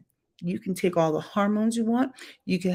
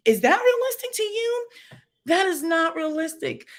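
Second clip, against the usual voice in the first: incredulous, much higher pitch; 8 semitones higher than usual. Real, not acted. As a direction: scoff at the very idea. Mocking a realistic claim.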